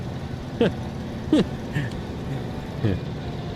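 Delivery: soft airy chuckle; breathy laugh. Real, not acted. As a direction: let out a quiet breathy laugh. Laughs at own joke.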